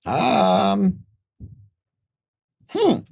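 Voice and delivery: very high, squeaky humming; small creature mulling it over; put-on high hesitant voice; little merchant pondering. Merchant character ponders second price.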